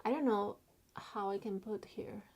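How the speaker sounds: ordinary talk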